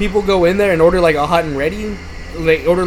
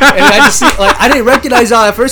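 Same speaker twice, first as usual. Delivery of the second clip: laughing; 6 semitones higher, 7 dB louder than usual. Real, not acted. Laughing recalling the prank.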